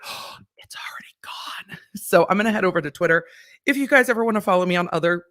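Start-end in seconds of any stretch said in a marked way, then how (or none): 0.0-1.8 hushed, breathy whisper. Retold or imitated. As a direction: whisper it like a secret. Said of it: "And I was like, gasps".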